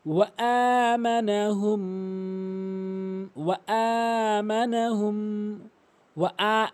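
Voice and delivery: ওয়া, then a separate long আ in আমানাহুম; correct elongation. Shown as a demonstration. Teacher shows one alif, drawn-out আ in আমানাহুম. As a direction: chant it slowly, holding আ and the final ম. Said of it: "এক আলিফ টেনে উচ্চারণ".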